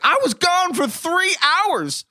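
high, slow, whiny protest; Ricky Bobby quote. Retold or imitated. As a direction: whine it like the betrayed husband. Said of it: "where he's like".

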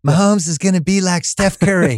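mock your co-host's take on your own accent; imitation of an imitation. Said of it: "my imitation of your imitation of me".

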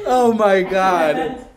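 slow, cracking up through the words; laughing so hard it almost cries; real helpless laughter. Real, not acted. Friend cracking up during call.

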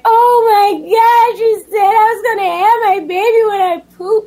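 mock sobbing wail; crying. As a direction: wail it high like a tearful lament. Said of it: "remember crying like".

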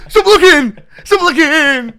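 high, loud panicked shout; panicked yell. Pretend. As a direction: yell at the kids to stop looking. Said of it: "You'd just be like".

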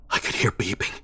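ordinary delivery, nothing marked

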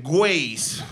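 lightly Mexican-accented Waze, with a hard k: kweɪz. Shown as a demonstration. Comic repeats app name to LA crowd in Mexican accent. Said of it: "Let me say it in Mexican".

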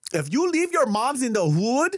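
high, slow imitation of a Toronto girl; the girl's voice. Asking what the girl meant, high drawn-out imitation. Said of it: "So when she was saying".

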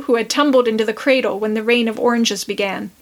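ordinary delivery, nothing marked

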